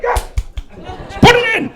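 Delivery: high, loud mock demand; hammed-up desperate cry; desperate shout. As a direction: yell it like a frantic coffee addict. Acted desperate plea for coffee syringe.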